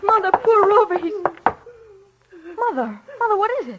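high, urgent cry to mother; alarmed distress. Acted. Daughter bringing mother news of the dead dog, high and distraught.

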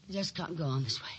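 ordinary talk, nothing marked

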